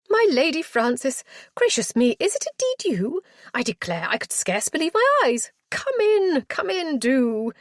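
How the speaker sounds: put-on high, fluttering, flustered old matron. Matron greeting her lady visitor, high and flustered. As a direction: gush over the guest in a high flustered voice. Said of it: "clearly flustered".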